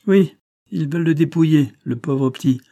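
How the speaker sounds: put-on indignant old grocer; agitated repeat. A narrator's reading. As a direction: hammer the point home again. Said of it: "répétait-il".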